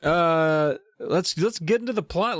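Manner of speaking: bright, high push into the plot; rally the listeners, pitch up; excited start. Real, not acted. Host kicking off the plot, pitch up with excitement.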